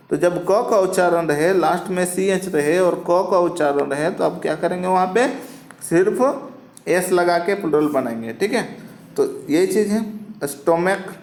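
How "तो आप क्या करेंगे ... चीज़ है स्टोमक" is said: usual speaking voice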